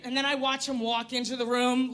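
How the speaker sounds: ordinary talk, nothing marked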